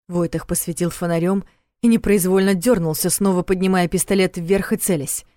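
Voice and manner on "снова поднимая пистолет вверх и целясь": ordinary delivery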